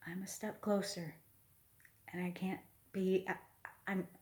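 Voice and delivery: whispered, choked with joy; a soft whisper full of happiness; emotional whisper. Real, not acted. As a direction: hush it, barely holding back tears. Overwhelmed with happiness, near tears.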